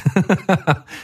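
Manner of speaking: a short high laugh. Real, not acted. Laughing at his friend's correction, high and short. Reacting to the joke.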